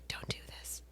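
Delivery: barely audible pleading whisper; imitated whisper. Mother pleading with son, imitated whisper. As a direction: whisper it as if into an ear. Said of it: "whispers into his ear".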